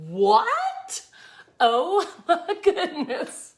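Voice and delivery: high, loud laughing disbelief; floored. Retold or imitated. Seeing her page likes at Sonic, high laughing shock. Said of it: "I was floored".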